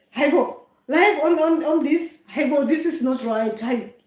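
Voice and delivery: ordinary delivery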